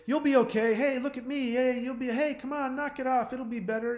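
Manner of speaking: high, insistent hey-come-on coaxing; pushy comforters. Retold or imitated. People pestering the grieving actor to cheer up, mimicked high and pushy. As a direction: mimic people nagging him to stop crying, high and pushy. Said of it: "Everybody else would walk up to me and say".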